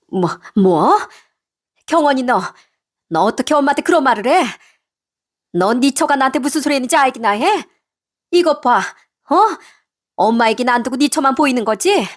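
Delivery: mother-in-law's indignant scolding, moderately heated; angry. Acted. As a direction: turn on your son, affronted. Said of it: "화를 내기 시작했습니다".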